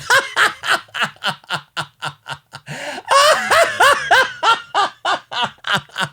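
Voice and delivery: long, high staccato cackle; drawn-out derisive laugh in bursts; mocking laugh. Real, not acted. Host scoffing at credit given to McMahon, mocking laugh.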